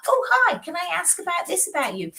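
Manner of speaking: sing-song high sugary greeting; mock cheery salesy hello; fake friendly stranger. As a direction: play the pushy stranger, bright and high. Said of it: "and then just go".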